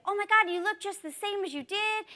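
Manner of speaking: retold gush of recognition; amazed greeting. Teacher retelling her reply to a former student, amazed.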